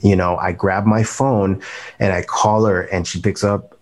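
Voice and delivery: ordinary talk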